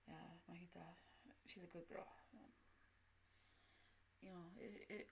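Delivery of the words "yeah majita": whispered name; voiceless confiding whisper. Real, not acted. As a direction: breathe the name out so it stays private. Keeping a name private.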